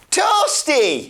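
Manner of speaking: a high, squeaky mock Toasty shout; Toasty Guy cry. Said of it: "It is Toasty Guy".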